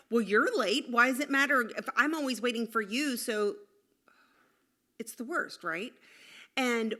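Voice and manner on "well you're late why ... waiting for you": put-on snippy kid comeback. Acted kid talking back.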